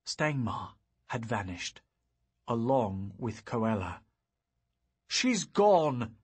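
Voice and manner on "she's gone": higher, louder angry outburst; fuming. Acted. Young wizard discovering the mare stolen, angry outburst. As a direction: spit it out in frustration. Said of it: "I fumed".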